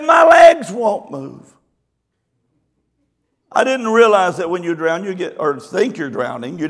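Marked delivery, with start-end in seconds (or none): 0.0-1.5 high, loud panicked yelling; frantic cry for help. Retold or imitated. Ladies flailing in the river, shrill loud cries for help. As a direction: scream for help like you're drowning.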